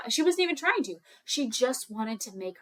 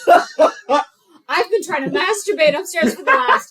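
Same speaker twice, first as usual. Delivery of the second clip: loud, higher mock rant with laughs creeping in; exasperated mom; 7 semitones higher, 12 dB louder than usual. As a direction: rant like a fed-up mother, hammed-up. Acted exasperated mom's rant.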